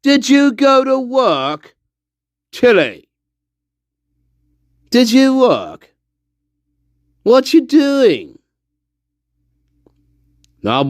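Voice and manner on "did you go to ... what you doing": high, slow, drawn-out questions; high teasing voice. Pretend. Streamer teasing Tilly about skipping work, high and slow. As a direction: tease Tilly in a high, slow sing-song.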